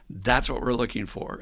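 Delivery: ordinary talk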